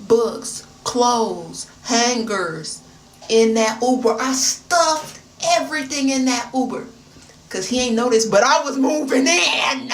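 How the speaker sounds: loud, punched-out list building to a shout; loud comic exasperation; emphatic telling. Real, not acted. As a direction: hit each item hard, then build. Emphatic list of everything she moved.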